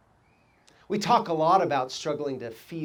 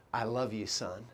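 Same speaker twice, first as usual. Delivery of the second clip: soft, lower tender line; tender words; 7 semitones lower, 10 dB quieter than usual. Retold or imitated. Coach telling his student, soft tender voice. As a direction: say it gently like a caring father.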